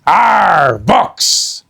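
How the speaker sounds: loud pirate growl; Captain Bones grumbling about books; disgusted pirate. Acted.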